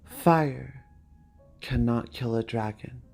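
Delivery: ordinary talk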